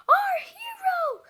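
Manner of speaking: high, slow, loud cheer; drawn-out hero-worship cry; adoring shout. A narrator's reading. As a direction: sing out gushing praise. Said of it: "the boys shouted".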